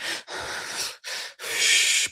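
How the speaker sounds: long, slow exhale; acted box breathing, drawn-out sigh. Upset woman unable to talk to him, slow breathing. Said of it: "breathing slowly and just, like, sort of disassociating".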